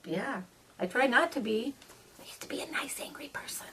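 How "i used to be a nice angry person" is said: whisper. Pretend. Whispered joke confession.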